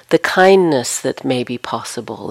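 ordinary talk

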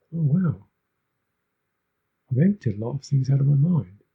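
lightly acted wonder, lifted pitch; mock amazement. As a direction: voice a pleased, impressed meditator. Voicing a meditator impressed by their practice.

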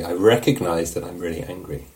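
ordinary delivery

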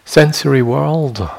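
ordinary talk, nothing marked

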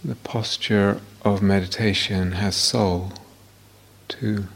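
guided meditation. Real, not acted. Teacher leading students into body awareness, guided meditation voice. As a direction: guide them unhurriedly, leaving silence between phrases.

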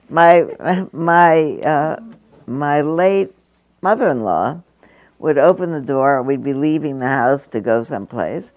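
ordinary talk

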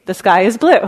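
the sky is blue tossed out loud and bright; playful punchline. Retold or imitated. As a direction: land The sky is blue as a cheerful joke. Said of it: "she would add the phrase".

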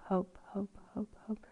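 soft, fading into a whisper; fading hope. Retold or imitated. Mimicking fading hope between songs.

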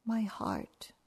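put-on softly whispered, small child voice; a shy confession; tiny whisper. Older girl quietly naming her hurt to grandma, whispered. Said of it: "And she said".

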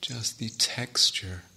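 slow, measured meditation guidance with long pauses; guided meditation. Real, not acted. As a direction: guide the sitters gently, leaving space between phrases. Leads a guided sitting meditation.

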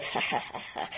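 breathy mock laugh of the teacher; imitated teacher laugh. Imitating the Zen teacher laughing at a student's answer. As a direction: puff out a dismissive ha ha ha. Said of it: "He usually goes".

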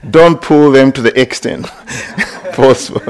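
amused, laughter breaking into the words; laughing. Real, not acted. Joking about pulling earlobes.

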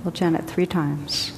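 normal talking voice

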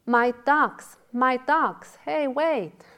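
loud, high calls after the ducks; shouting. A narrator's reading. Dull-witted man running after his ducks, shouting. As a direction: call out high and loud, possessively.

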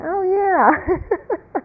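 high, reluctant oh yeah; half-hearted agreement. Retold or imitated. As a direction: humour him with a limp yes. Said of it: "And I'd look and go".

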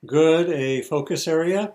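usual speaking voice

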